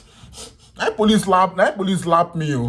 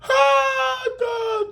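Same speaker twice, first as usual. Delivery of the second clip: crying out. Retold or imitated. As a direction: wail it out like breaking down alone. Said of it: "I can't cry".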